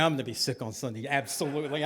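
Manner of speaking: low, fast, quiet aside; muttered aside. Acted. Child privately planning to skip Sunday school, quick low mutter. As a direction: mutter the real plan under your breath.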